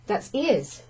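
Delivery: ordinary delivery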